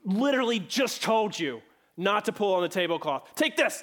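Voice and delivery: mock-furious mother dumping the lemonade; angry mom. Mom scolding disobedient Johnny, acted angry outburst. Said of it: "Her anger drives her to rush across the room".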